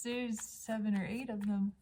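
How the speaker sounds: ordinary talk, nothing marked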